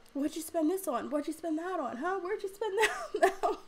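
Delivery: grilling with rising pitch, breaking into laughter; mock interrogation. Wife checking up on the bank account, mock interrogation. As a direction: fire off nosy questions, pitch climbing into a laugh.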